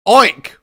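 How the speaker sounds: loud high oink, like a squealing boar; loud pig squeal. Retold or imitated. Reader mimics the boar with a loud high squeal.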